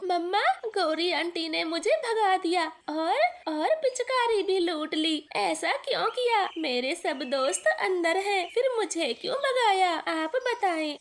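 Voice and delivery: put-on a little boy sobbing out his complaint; crying child. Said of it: "बाहर खड़े रो क्यों रहे हो".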